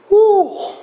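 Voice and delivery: high astonished oh; amazed oh. Acted. Jesus amazed at the centurion's faith, high astonished oh. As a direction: marvel out loud at the faith.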